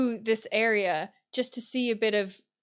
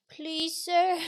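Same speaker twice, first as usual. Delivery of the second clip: mock pleading; 7 semitones higher than usual. Pleading with the mechanic.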